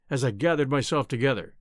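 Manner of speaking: ordinary delivery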